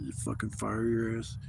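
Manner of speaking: ordinary talk, nothing marked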